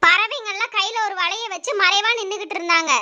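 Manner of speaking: ordinary delivery, nothing marked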